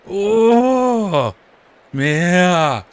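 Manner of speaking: high, loud drawn-out cry of dismay; hammed-up commentator's groan; dismayed wail. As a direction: wail as if your favorite just got robbed. Dismay at Lou losing his chance.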